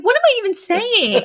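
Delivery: high, hammed-up derailed outburst; Fran. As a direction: imitate a sitcom heroine thrown off track. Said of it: "Fran just is like".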